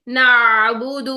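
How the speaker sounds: نعبد with the نون vowel stretched long, said slowly; wrong elongated vowel. Shown as a demonstration. Quran teacher showing students the wrong way, drawn-out نعبد. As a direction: drag out the vowel before عین in نعبد as the mistake. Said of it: "پچھلے حرف کی حرکت آپ کی لمبی کیسے ہوگی".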